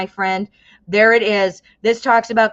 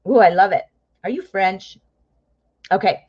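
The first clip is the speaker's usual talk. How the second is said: ordinary delivery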